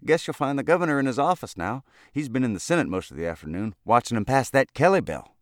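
high, loud cheery chatter; cheerful. A narrator's reading. Elevator boy directs the lobbyist, cheerful. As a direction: rattle it off brightly as a helpful boy. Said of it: "said Freckles cheerfully".

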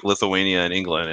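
normal talking voice

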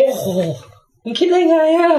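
stunned by the stunt; how did they think of it, in disbelief; amazed. Real, not acted.

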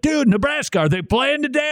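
high, eager fan question; all excited about the game. Retold or imitated. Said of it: "All excited about it".

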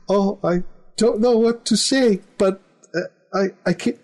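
high, hesitant Eastern European daughter. Retold or imitated. Taxidermist's daughter turning down the proposal in a high, halting accent. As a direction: stammer the refusal like the shy accented daughter. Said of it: "So [name] is acting".

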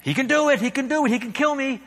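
high, urgent lizard voice; a frantic little demon pleading; chattering lizard. A narrator's reading. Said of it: "lizard begins chattering".

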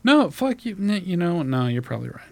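high protest deflating to a soft mumble; defiance collapsing into surrender. Retold or imitated. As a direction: start high, then cave in quietly.

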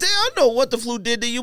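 ordinary delivery

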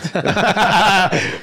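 loud laugh. Real, not acted. Joke about getting flagged.

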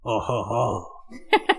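low, slow, hammed-up French-accented chuckle; a lightly done French "Oh, ho, ho", drawn out low; mock French accent. Host replaying his French accent for chat, slow mock chuckle. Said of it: "my best attempt at a French accent".

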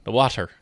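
"water" with the T on the tip of the tongue; touch the tongue tip for the T in "water". Shown as a demonstration. Coaching cohost on water, tongue-tip T. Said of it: "put the T at the tip of your tongue".

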